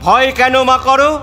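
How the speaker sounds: loud, high call to a mother; calling out reassurance. A narrator's reading. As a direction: call out boldly to calm her. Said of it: "তোমায় বলছি ডেকে".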